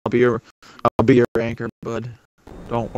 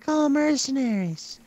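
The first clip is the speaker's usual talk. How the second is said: much higher put-on voice; imitating Luigi; 13 semitones higher, 3 dB quieter than usual. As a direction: do a high cartoonish impression. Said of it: "one of Luigi's favorite things to do".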